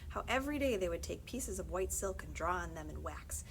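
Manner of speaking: ordinary delivery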